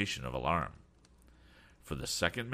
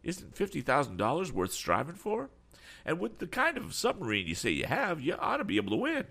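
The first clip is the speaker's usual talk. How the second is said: higher-pitched voice of a surprised eccentric; eccentric man, astonished; 12 semitones higher than usual. A narrator's reading. Eccentric man urging young inventor to enter the trials, astonished. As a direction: protest in lively, swooping disbelief.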